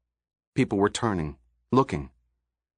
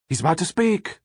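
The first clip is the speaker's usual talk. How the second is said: called out; 7 semitones higher than usual. A narrator's reading. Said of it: "people called out".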